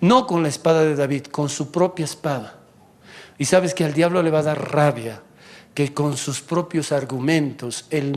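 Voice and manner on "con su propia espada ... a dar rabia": ordinary talk, nothing marked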